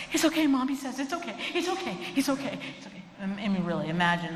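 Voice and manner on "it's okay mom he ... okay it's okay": high, fretful repeated reassurance; mock a panicky comforter, higher; nervous high-pitched "it's okay" babble; anxious over-soothing. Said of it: "As long as you're not doing this".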